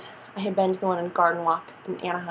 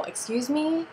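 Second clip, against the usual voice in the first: higher pitched, incredulous excuse me; indignant excuse me; 8 semitones higher, slower than usual. Retold or imitated. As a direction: retell it as pointed disbelief at the waitress. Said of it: "I go".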